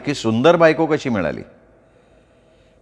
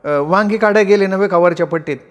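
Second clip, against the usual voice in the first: put-on higher, startled rustic girl; surprised village girl; 7 semitones higher than usual. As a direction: react to the master's sudden visit. Girl answers master. (अचानक मालक पाहून चकित तरुणी)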